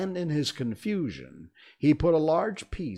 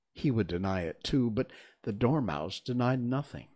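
plain reading voice

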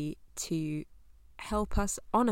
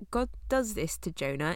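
ordinary delivery

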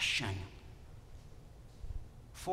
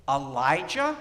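usual speaking voice

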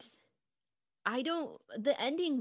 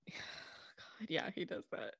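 breathy exasperated whisper; 3 semitones lower, 8 dB quieter, much slower than usual. Real, not acted. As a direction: sigh it out under your breath. Exasperated aside after a sigh.

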